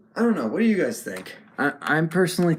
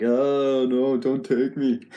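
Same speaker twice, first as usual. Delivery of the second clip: louder, drawn-out pretend pleading; mock sad; 3 semitones higher, 5 dB louder, slower than usual. Voicing the sad-looking truck, mock pleading. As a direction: wail it a little, playing a sad toy. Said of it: "he's more sad looking, as you can see. Like, he's like".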